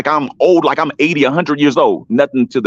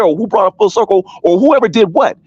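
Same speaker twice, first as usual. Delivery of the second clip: usual speaking voice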